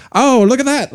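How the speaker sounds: high, loud mock Aussie; Australian accent. Retelling Crocodile Dundee, high loud Australian voice. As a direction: play the delighted Aussie diner. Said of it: "And the guy's like".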